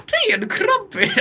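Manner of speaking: very high, hammed-up British accent; squeaky caricature British voice; Yashin. Shown as a demonstration. Podcaster joking with co-hosts about the character, squeaky mock British. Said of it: "I'll make her even more British".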